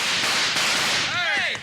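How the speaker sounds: sudden loud call of alarm; shout. Acted. The Kid shouting to halt the men, loud alarm. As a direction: yell it out to stop them.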